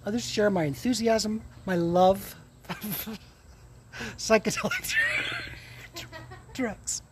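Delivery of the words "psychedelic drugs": words broken up by real laughter; giggling. Real, not acted. Vlogger confessing love of psychedelics to viewers, giggling. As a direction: try to finish the phrase through giggles. Cracks up naming what he loves.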